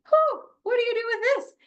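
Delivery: playing your own bewildered self; mock parental overwhelm; exasperated. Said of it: "it's a little bit like".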